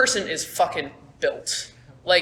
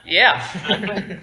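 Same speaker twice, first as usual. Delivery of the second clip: ordinary talk, nothing marked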